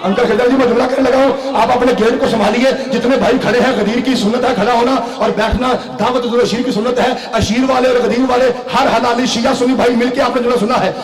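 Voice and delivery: loud, rousing sermon delivery to the crowd; fiery preaching. Acted. Crowd-rousing preaching at full force.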